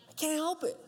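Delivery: put-on high whiny little-kid voice; mock pleading kid; whiny child. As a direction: whine it like a child caught out.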